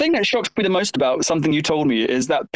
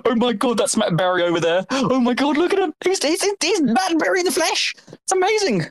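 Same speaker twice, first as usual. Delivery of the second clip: starstruck gasp; 7 semitones higher than usual. Retold or imitated. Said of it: "We're like".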